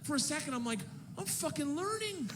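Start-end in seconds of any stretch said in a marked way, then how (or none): 1.2-2.3 much higher, excited squeal of discovery; acting out giddy pride, pitched way up; thrilled boast. Elated at understanding Russian.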